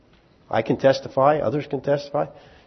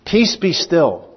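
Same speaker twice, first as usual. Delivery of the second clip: calm command; 6 semitones higher, 4 dB louder, slower than usual. Acted. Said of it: "It's not time to die".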